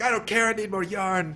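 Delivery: a hammed-up desperate little yarn creature; greedy Yarny. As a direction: play Yarny tearing off his arm for yarn.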